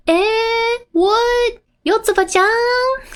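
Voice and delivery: put-on high, sing-song puzzled little girl; surprised child voice. Child looking back for her passenger, high sing-song surprise. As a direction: call out, baffled, high up. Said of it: "And then".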